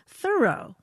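Thoreau said THUR-oh; accent on first syllable. Shown as a demonstration. Host reporting how neighbors said it, Thoreau as THUR-oh. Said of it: "with the accent on the first syllable".

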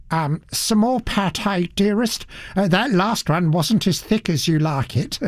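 a hint of an attentive husband; doting husband. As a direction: fuss over her food like a doting spouse. Husband fussing over wife's food.

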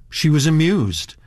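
ordinary delivery, nothing marked

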